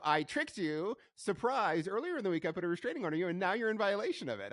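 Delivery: high-pitched mock gotcha announcement. Podcaster mimicking a wrestling manager's absurd restraining order trick, mock gotcha. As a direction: play a gleeful trickster, pitched well up. Acted gotcha in mocking a storyline.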